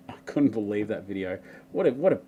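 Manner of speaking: usual speaking voice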